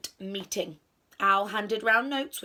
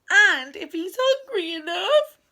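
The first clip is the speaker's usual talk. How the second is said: high, loud mouse cry; 10 semitones higher, 10 dB louder than usual. A narrator's reading. Mouse adds a worry about the monster, crying out. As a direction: pipe up loudly in alarm. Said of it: "cried the Mouse".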